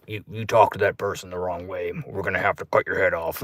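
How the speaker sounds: low, soft menacing sentence; a hint of a quiet killer's judgment; lightly voiced Jigsaw.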